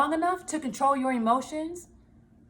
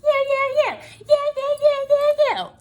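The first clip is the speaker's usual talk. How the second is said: much higher and louder nagging chant of you; mocking a blamer, chanting you, much higher and louder; mocking finger-pointing; 13 semitones higher, 6 dB louder than usual. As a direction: jab each you high and shrill like blaming. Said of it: "keep pointing the finger".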